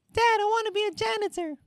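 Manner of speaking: put-on high, fast little-kid voice; squeaky rushed child plea; whiny kid falsetto. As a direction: pipe it up like a small child. Kid answering the dad.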